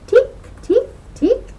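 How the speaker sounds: high, loud tapping ticks; loud hammer ticks. A narrator's reading. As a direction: ring out each tick like a little hammer strike.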